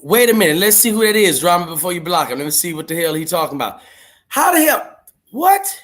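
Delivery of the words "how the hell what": much higher, louder incredulous cry; shocked disbelief. Real, not acted. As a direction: react like you just caught someone stealing your name. Sees impostor using his name.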